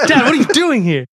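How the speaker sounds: joking incredulous question; mock surprise. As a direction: play startled at running into your father. Joking pretend encounter with dad.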